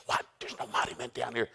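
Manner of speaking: soft, furtive retort; hushed protest. Retold or imitated. Hiding Gideon answers the angel, hushed protest.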